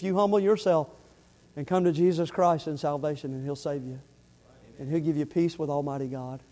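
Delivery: low, soft, earnest pulpit voice; hushed preaching appeal. Real, not acted. Preacher urging listeners to come to Christ, hushed and earnest. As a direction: bring it right down, sober and intimate.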